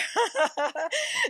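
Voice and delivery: high burst of real laughter; laughing. Real, not acted. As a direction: crack up at your own story. Laughs at her own story.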